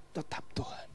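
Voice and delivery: hushed unvoiced close; soft whispered faith. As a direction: drop to a whisper. Drops to whisper.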